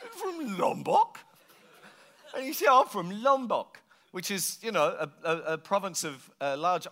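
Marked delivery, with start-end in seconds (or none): 0.1-1.2 high, slow, rising disbelief; incredulous echo. Acted. Visitor questioning the local's unlikely origin, incredulous. 2.7-3.8 slow, higher mock British accent; strong British accent. Island local confirming his home to visitor, strong British accent. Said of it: "You've got a very strong British accent".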